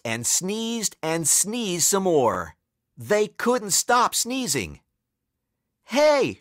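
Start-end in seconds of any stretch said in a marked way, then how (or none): 5.8-6.4 high, indignant fan's call; high-pitched "hey" across a stadium; shout. A narrator's reading. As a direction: holler from the bleachers. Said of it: "shouted a fan in the bleachers".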